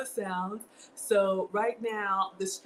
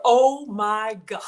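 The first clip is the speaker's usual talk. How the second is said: louder, slow amazed oh my God; stunned; 9 dB louder, slower than usual. Real, not acted. Cut off by a donation, slow stunned exclamation. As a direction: stop short, overwhelmed. Overwhelmed mid-sentence.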